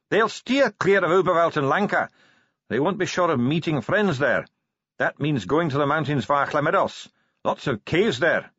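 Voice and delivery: light, high dwarf voice; cheerful dwarf. Acted. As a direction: pitch it up as an affable dwarf.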